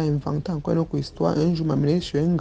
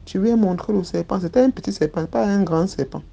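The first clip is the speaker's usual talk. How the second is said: ordinary talk, nothing marked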